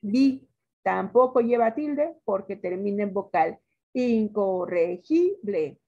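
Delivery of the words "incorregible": "Incorregible" slowly split into syllables, GI marked; stress on GI. Shown as a demonstration. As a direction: sound out "Incorregible", leaning on "gi". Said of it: "palabra grave que no lleva tilde". ("Incorregible" sílaba por sílaba, marcando GI)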